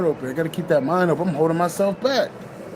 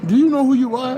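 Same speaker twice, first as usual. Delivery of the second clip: higher, rising into a mock scolding; a hint of a stern elder calling him out; Big Mama scolding; 6 semitones higher than usual. Big Mama checks her boy, lightly voiced higher scold. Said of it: "Big Mama like".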